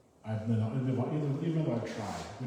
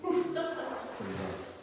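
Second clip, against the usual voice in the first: much higher and slow, a drawn-out mock wail; mock dismay; 13 semitones higher, much slower than usual. Reader judging his own bad German accent, mock wail. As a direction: whine it out high and long, as a joke.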